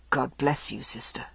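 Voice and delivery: low, soft mumbled blessing; muttered. A narrator's reading. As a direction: mutter it as you turn away. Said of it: "and muttered".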